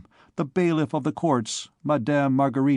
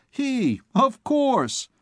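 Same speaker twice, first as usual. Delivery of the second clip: much higher mocking cry; 11 semitones higher, much slower than usual. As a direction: taunt with glee. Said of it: "continued the small imp".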